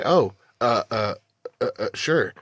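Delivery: halting, stuck-for-an-answer stammer; acted flustered hesitation. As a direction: stall on each uh before agreeing. Actor thrown by director's note.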